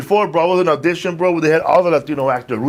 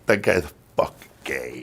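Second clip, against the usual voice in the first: low muttered insult; 10 semitones lower, 5 dB quieter than usual. Retold or imitated. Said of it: "this is how I fucked up myself".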